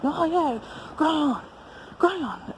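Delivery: high, imitated French accent. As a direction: say it like a French-speaking local. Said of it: "in, like, their French accent".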